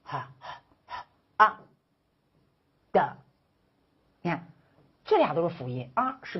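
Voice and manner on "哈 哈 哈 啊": "哈" as an unvoiced h followed by a voiced 啊; sounding out 哈. Shown as a demonstration. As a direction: split "哈" into its breathy h and the vowel 啊. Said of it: "你看哈字".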